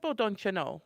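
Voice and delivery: put-on playful Minnesota accent, church lady gossip. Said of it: "in Minnesota, church ladies".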